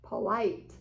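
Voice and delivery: plain reading voice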